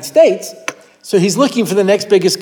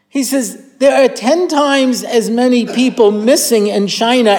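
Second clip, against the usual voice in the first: incredulous student; 6 semitones higher than usual. Retold or imitated. Chinese student questions his host about tiny Israel, incredulous and raised. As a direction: lay out the numbers in baffled disbelief.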